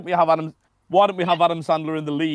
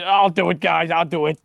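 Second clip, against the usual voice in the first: put-on Boston accent; 2 semitones higher than usual. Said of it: "they're like".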